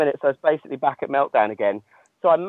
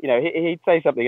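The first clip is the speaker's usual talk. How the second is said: normal talking voice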